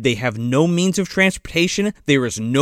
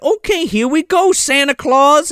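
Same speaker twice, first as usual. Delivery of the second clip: high, drawn-out cheery fairy; chirpy fairy; 9 semitones higher than usual. Retold or imitated. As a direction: announce the trip brightly. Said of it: "she's like".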